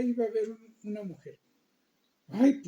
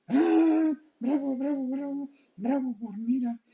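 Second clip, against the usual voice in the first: cheering; 5 semitones higher than usual. Real, not acted. Child listener cheering for Queen Mira, excited. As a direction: cheer her on like at a show.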